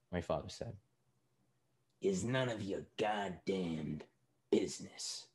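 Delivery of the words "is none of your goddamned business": slow, hushed rebuke; restrained anger. A narrator's reading. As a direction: drop to a very soft, menacing voice. Father rebuking nosy waiter.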